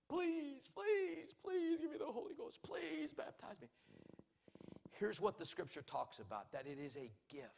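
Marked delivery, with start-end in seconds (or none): 0.1-3.7 very high, strained imitation of pleading; begging God. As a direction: act out desperate begging in a thin put-on high voice. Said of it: "it is one thing to beg God".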